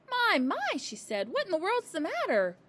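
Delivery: surprised concern. A narrator's reading. Teacher sees running child.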